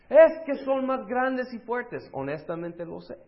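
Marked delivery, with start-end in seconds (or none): 0.1-2.1 high, loud, slow fearful complaint; whining crowd. Acted. Israelite whining to Moses about Egyptians, high loud whine. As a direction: plead it like a terrified grumbler. Grumbling Israelites voiced in sermon.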